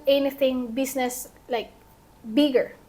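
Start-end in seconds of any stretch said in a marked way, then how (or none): none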